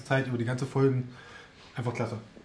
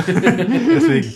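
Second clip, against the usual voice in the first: laughing; 11 semitones higher, 9 dB louder, much slower than usual. Real, not acted. Laughing while speaking.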